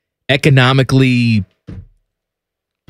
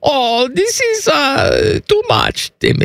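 put-on coy German accent, pitched up high; 13 semitones higher, slower than usual. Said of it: "if you keep speaking in a German accent".

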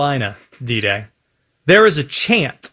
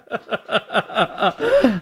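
real chuckling laugh; amused laugh; 6 dB quieter than usual. Real, not acted. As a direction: laugh at the co-host's candor. Reacting to Cowboys hot take.

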